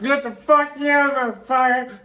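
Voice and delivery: high, slurred mock yell; drunken yelling. Drunk friend yelling at guys, mock yell. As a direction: bellow it like a wasted partygoer. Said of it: "making it super loud".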